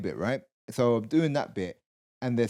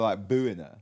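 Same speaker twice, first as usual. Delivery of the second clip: normal talking voice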